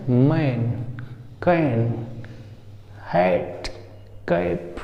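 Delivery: longer "ae" in मैन, कैन, हैट, कैप; open, held vowel in मैन, कैन, हैट, कैप. Shown as a demonstration. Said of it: "ये उसका बड़ा भाई आए".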